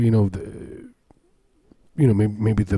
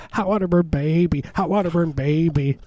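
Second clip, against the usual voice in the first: higher, faster doll catchphrase; pull string toy; 6 semitones higher than usual. Retold or imitated. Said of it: "Rain Man dolls, where you pull it".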